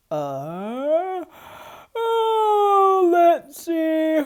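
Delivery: loud yawning sleepy bear. A narrator's reading. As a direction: yawn the words out drowsily. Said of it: "Bear said with a yawn".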